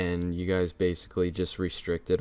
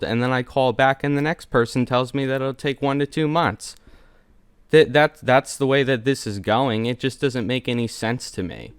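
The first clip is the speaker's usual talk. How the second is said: louder, higher, heated objection; heated complaint; 4 semitones higher, 5 dB louder than usual. Real, not acted. Customer arguing with the PayPal agent, heated. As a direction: push back hard at the agent.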